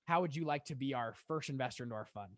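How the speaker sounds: ordinary talk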